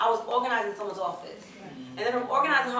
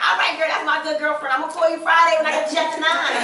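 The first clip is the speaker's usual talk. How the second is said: excited; 7 semitones higher, 9 dB louder than usual. Retold or imitated. Retold goodbye, loud.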